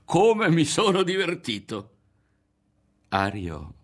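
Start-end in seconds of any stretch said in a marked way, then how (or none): 0.1-1.9 high, loud delight; hugely tickled, pitched right up; bursting with amusement. A narrator's reading. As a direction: crow it with glee. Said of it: "scoppiò a ridere Berto".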